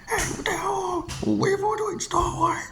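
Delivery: high-pitched alarmed protest. Acted. Engine protesting as the others act strangely, alarmed. Toy engines react to sudden trouble.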